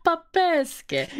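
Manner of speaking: high, put-on Russian accent; mock Russian accent. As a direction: have a playful go at a Russian accent. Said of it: "you are doing it in the Russian accent".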